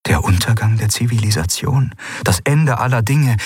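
usual speaking voice

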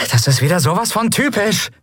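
high, exasperated outburst; cursing. A narrator's reading. Endriel stamping at burning boards, high exasperated cursing. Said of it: "Fluchend versuchte Endreel".

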